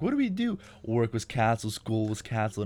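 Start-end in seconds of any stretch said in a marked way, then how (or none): 0.0-0.6 high squeaky panicked question; mock panic. Californians panic over an inch of snow, high mock panic. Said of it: "They're like".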